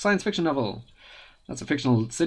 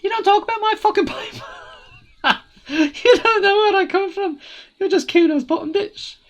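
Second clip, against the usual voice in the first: much higher, laughing outrage; furious squeaky kid; 7 dB louder than usual. Acted. The pipo-headed kid raging at the cop over his hat.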